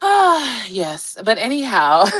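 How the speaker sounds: normal talking voice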